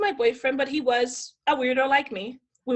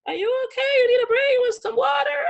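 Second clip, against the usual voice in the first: high-pitched, anxious fussing; mock alarm, pitched way up; concerned photographer; 11 semitones higher, 4 dB louder, faster than usual. Photographer checking on the crying model, high worried voice.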